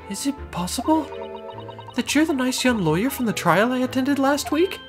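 high-pitched refined lady. Acted. Client recognizes lawyer.

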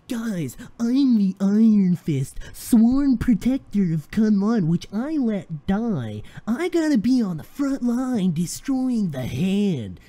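slow, hammed-up hero with an odd accent; loud, drawn-out mock-heroic declaration; Danny Rand impression. Fan mocking Danny's vow to fight, hammed-up slow impression. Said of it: "I don't know what impression that was".

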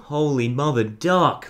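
ordinary delivery

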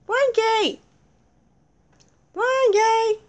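high-pitched searching call. Pretend. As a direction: call the name high, drawn out. Searching and calling for Frankie.

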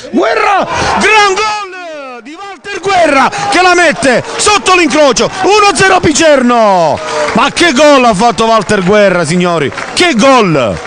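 goal call. Real, not acted. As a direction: erupt as the ball hits the net. Goal scored, commentator erupts. (urlo da gol)

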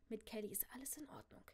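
quick whispered soothing; hushed reassurance. Acted. Father turns back to his niece, whispering reassurance. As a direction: drop to a whisper to settle her.

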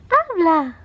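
high coaxing call; coaxing baby talk. Acted.

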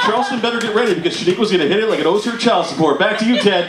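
high, urgent live weather report; hammed-up TV reporter in a hurricane; storm field reporter. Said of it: "they go live to Steve".

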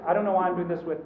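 ordinary talk, nothing marked

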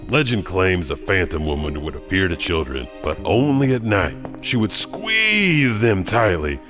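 ordinary delivery

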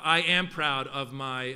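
ordinary talk, nothing marked

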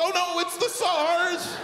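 high, slow mock fright; mock panic. Mocking people who panic whenever someone coughs, in a high hammed-up fright. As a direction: squeal it like a hammed-up scaredy-cat.

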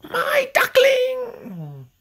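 very high, slow, loud delighted cry; joyful cry. Acted. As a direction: cry out with joy at the hatchling. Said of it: "cried Jemima".

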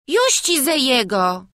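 put-on high, loud childish peasant voice; small boy. A narrator's reading. Wawrzuś confirms it to the burmistrz, child's voice. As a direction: answer as a little village lad.

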